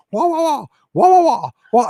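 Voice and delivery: high, loud mocking wah-wah noises. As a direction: mimic squabbling as baby noise. Mocking the two arguing.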